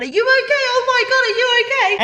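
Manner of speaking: very high-pitched imitation of a worried onlooker; retold alarmed shouting. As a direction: mimic a woman calling down in alarm. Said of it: "woman at the top of the escalator shouting down".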